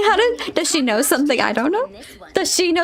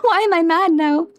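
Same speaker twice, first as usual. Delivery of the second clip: normal talking voice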